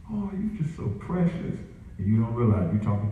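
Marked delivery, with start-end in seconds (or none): 0.1-1.6 high, soft mock adoration; cooing admirer. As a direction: gush softly as over something precious. Cooing over disguised cute demon.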